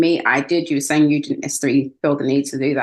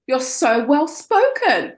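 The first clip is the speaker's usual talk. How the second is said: mock patronising praise; 8 semitones higher, much slower than usual. Doctor's patronising compliment retold.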